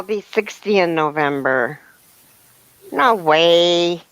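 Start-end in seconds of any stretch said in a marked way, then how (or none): none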